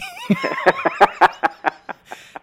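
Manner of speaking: big high laugh bursting out; real delighted laugh; burst of laughter. Real, not acted. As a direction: let a high, helpless laugh break out.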